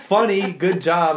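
loud, high mock congratulation; sarcastic loud good job; sarcastic praise. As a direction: sneer it as fake praise. Mocking the heckler sarcastically.

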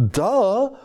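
high, mocking Kardashian-style duh; mock ditzy vocal fry. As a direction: sneer it like a vapid valley girl. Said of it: "Vocal fry".

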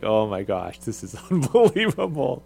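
loud, amused mock dismay; laughing disbelief. Assistant coach recalling tournament chaos, laughing disbelief. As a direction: relive the shock, laugh breaking in. Retells own reaction to game chaos.

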